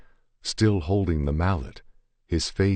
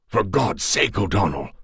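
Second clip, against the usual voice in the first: higher-pitched alarmed protest; urgent plea, pitched up; urgent exclamation; 5 semitones higher, 4 dB louder than usual. A narrator's reading. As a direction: cry it out to stop a friend. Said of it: "exclaimed Conrad".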